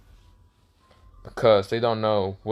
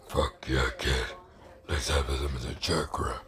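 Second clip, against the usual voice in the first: Kurama demon voice; 10 semitones lower, 9 dB quieter than usual. Acted. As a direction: drop far down into a quiet beast voice.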